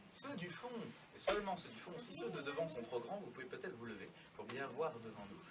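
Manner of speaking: ordinary delivery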